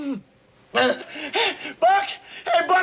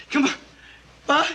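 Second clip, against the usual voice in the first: ordinary talk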